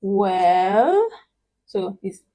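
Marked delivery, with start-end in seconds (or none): 0.0-1.1 WELL stretched, falling then rising. Shown as a demonstration. Teacher illustrating doubt, dipping and rising "Well". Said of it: "this down and up".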